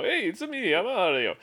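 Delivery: put-on high, hammy Italian accent Mario voice; terrible Italian accent. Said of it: "Chris Pratt with a terrible Italian accent".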